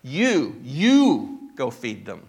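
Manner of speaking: "You" pressed hard, then "you go feed them" slow and firm; emphatic you. Shown as a demonstration. Pastor shows the church Jesus's emphatic command, slow and firm. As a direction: lean hard on "you", slowly. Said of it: "that "you" is emphatic".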